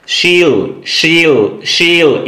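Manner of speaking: SHE'LL as one drawn-out syllable, sheel; contracted she'll. Shown as a demonstration. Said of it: "यानी कि she will".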